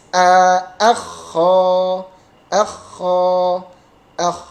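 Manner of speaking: AKHO with a light front a, then long scraped kho; thin a. Shown as a demonstration. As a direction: keep "a" thin before the heavy kh. (a tipis, lalu kho tebal)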